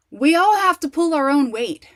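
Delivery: a loud, high, preachy jab at the cliché; sarcastic preachy quote; mocking loud lecture. Mocking clichés she has heard.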